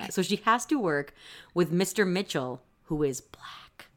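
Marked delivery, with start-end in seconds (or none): 2.8-3.9 hushed, slow whisper; whispered aside. Real, not acted. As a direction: drop to a secret whisper. Pointed aside.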